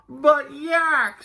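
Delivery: high, drawn-out wail; protesting Walrus. A narrator's reading. Walrus trying again to interrupt Yak, plaintive. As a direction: plead against being brushed off.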